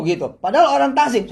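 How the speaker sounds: normal talking voice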